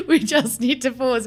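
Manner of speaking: laughing through the words; giggling, can't stop laughing. Real, not acted. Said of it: "I'm literally crying".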